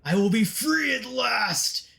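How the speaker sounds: high, drawn-out villainous cry; villain's triumphant shout. Acted. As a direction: roar out your victory over a mortal. Imprisoned villain exults in hero's mind.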